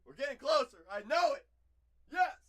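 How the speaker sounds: loud, high cheering; excited cheering. Real, not acted. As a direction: cheer like a goal.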